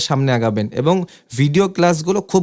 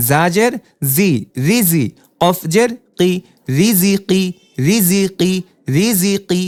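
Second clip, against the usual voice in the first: teacher's spelling drill cadence; syllable drill reading; slower than usual. Shown as a demonstration. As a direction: clear letter-by-letter spelling chant.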